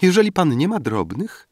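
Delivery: put-on high girlish voice cutting in; lightly voiced young girl interrupting. A narrator's reading. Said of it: "Paulina przerwała".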